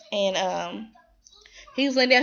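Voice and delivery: ordinary delivery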